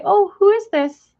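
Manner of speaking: squealed mock delight; high mock viewer voice. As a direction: play a delighted stranger meeting the bird. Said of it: "They say".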